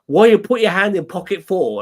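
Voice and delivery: loud, high imitation of the shopkeeper's broken English; Chinese shopkeeper accent. Tourist reaches into his pocket, shopkeeper shouts in accented English. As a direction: shout it like the startled shopkeeper with the bat. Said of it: "Like that".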